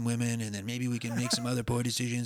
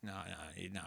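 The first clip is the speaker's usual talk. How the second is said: ordinary delivery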